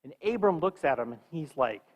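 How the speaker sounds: usual speaking voice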